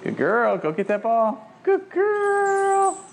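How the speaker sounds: gushing praise for the dog; excited dog praise. Real, not acted. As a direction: cheer the dog on.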